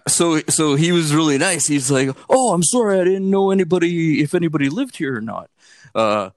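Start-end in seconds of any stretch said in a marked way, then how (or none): 2.3-5.5 polite homeless man. Retold or imitated.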